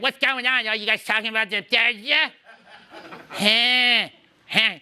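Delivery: high, odd character voice; weird voice. Retold or imitated. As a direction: mimic a high-pitched weirdo butting in. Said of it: "Like, this weird voice".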